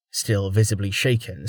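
ordinary delivery, nothing marked

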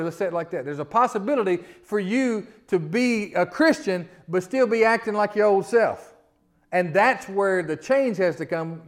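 loud, driving sermon delivery, rising high; preaching at full force. Acted. Preacher urging his church to shed old ways, at full force. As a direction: preach it hard to the whole congregation. Preacher reading then exhorting loudly.

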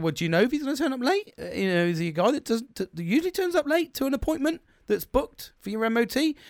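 retold challenge climbing high in disbelief; incredulous questioning. Customer questions mechanic about a late booking, incredulous.

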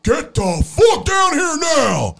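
loud, high, drawn-out biker yell. Retold or imitated. Man downstairs ordering the kids down, angry biker shout. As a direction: bellow it slowly like an angry biker. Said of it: "he sounded like a biker".

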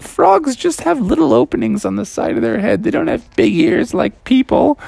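high-pitched amused mom voice; amused cartoon mom. Mom explains frog ears to Froggy, high and amused.

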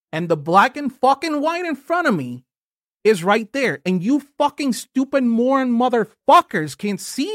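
heated rant. Real, not acted. Podcaster ranting at online critics, real heated fury. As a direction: blow up in real frustration, words tumbling out. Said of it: "Sorry that I got a little heated".

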